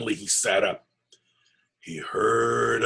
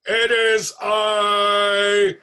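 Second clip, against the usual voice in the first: very slow, much louder monster roar; fearsome booming voice; 8 semitones higher, 8 dB louder, much slower than usual. Acted. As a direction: boom his name like a thunderclap. Goblin king arrives.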